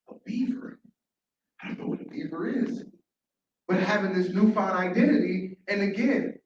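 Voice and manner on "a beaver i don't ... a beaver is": soft, almost whispered bewilderment; small, uncertain murmur; puzzled hush. Acted. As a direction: ask it quietly, lifting at the end. Creature puzzled at being renamed.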